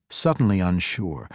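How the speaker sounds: plain reading voice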